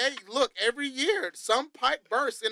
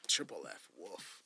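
quiet; 14 semitones lower, 12 dB quieter than usual. Pretend. Echoing dynamics joke.

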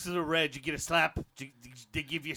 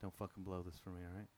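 much softer, lower aside in his own voice; dropping the accent, hushed; 7 semitones lower, 10 dB quieter than usual. Real, not acted. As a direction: hush it so the Englishman can't hear. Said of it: "I do the stupid accent".

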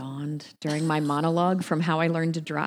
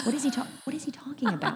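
laughing; 10 semitones higher, faster than usual. Retold or imitated. Confused, laughing at herself.

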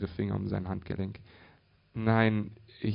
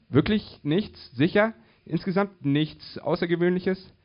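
high, loud, incredulous questions; urgent disbelieving probing; insistent questioning; 9 semitones higher, 5 dB louder than usual. Acted. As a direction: press for an answer, pitched up. Woman presses Bob in disbelief.

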